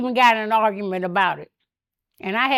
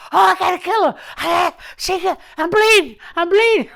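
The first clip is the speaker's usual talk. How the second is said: high, loud imitation of outrage; worked-up yelps, played for laughs; mock frantic yelling; 6 semitones higher, 6 dB louder than usual. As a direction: imitate a hurt man hollering. Imitates hurt man yelling in car.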